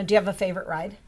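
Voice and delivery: normal talking voice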